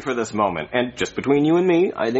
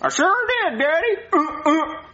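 put-on very high puppet child voice; squeaky, high kid impression with grunts; Jeffy; 11 semitones higher than usual. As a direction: answer as an eager kid character. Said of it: "So you're gonna play Jeffy".

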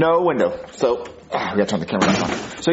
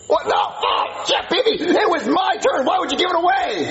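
outraged shout. Acted. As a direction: scream it like a cheated kid, slowly. Kid outraged toy given away.

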